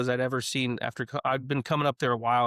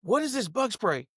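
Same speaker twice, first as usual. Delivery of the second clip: high, sharp call across the course; high alarmed call to a friend; retold shout; 9 semitones higher than usual. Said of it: "And I shout at Paul".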